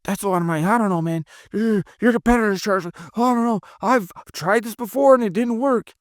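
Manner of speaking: mock-fretful, complaining customer; mock emotional prospect. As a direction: play the upset prospect complaining about the price. Acting an emotional, combative prospect.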